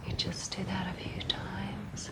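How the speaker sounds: soft, hushed meditation voice with long pauses; guided meditation. Acted. Leading listeners into a visualized walk on a rock path, soft guided meditation. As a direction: guide them gently, softly, leaving room to breathe.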